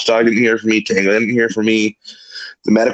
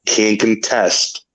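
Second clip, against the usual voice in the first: ordinary talk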